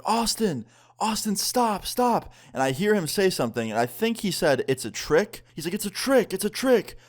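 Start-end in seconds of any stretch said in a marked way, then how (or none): 0.1-2.3 imitated yelling. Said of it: "one of my friends starts yelling". 5.7-7.0 high mock shout at normal volume; imitated yelling. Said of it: "one of my friends starts yelling".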